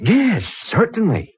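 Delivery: slow, high-pitched assent; eagerly impressed. Acted. Impressed patron agreeing to follow the librarian, eager. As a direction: agree slowly, pitch leaping up. Eager after being astonished.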